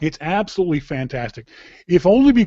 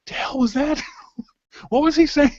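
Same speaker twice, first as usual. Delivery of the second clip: high, laughing mock confusion; imitating himself baffled by a thick accent; 13 semitones higher than usual. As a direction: replay your own puzzled reaction, cracking up. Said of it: "rewound, like, a couple times and gone".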